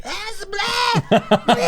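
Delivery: high, slow mock yell of gibberish; imitating yelling lady. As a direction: drag out nonsense like a crazed woman. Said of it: "like, intently yelling".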